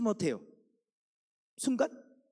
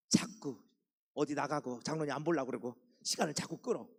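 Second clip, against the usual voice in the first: ordinary delivery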